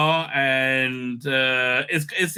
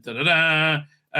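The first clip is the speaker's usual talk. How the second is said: ordinary delivery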